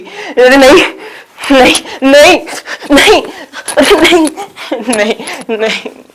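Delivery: loud, high cries dying away; pained pleading. Acted. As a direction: beg for the slaps to stop. Acts being slapped repeatedly. (मार खाते हुए चीख़कर गिड़गिड़ाना)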